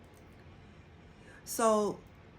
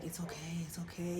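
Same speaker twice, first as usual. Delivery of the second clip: whispered, much softer comfort to herself; self-soothing whisper; 2 semitones lower, 8 dB quieter than usual. Retold or imitated. Comforting herself when partner leaves, soft whisper. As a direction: hush yourself calm. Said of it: "talk myself out of it".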